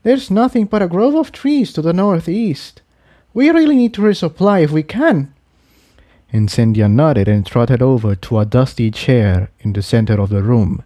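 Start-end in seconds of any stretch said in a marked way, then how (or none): 0.0-5.3 high, tender confession; lightly voiced mare, sincere; voiced mare confessing. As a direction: Tiptoe confessing love to Jigsaw. Character voice.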